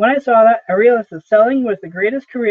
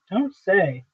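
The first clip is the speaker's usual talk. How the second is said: softer, disengaged reply; barely interested; 5 dB quieter than usual. A narrator's reading. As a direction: say it quietly, as if hardly listening. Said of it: "Howard, barely interested".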